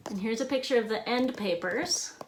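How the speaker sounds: ordinary delivery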